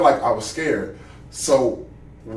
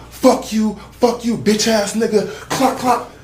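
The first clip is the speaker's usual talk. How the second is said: retold cursing shout; 7 semitones higher, slower than usual. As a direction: yell the curse, mock gun clicks at the end. Gunman cursing, clicking gun.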